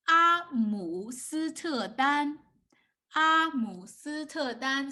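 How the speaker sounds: 阿姆斯特丹 slow, in five separate beats; slow syllables. Shown as a demonstration. Teacher poses long word to guess.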